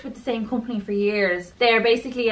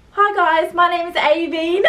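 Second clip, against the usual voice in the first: put-on London accent; 8 semitones higher, 5 dB louder than usual. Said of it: "try doing a London accent".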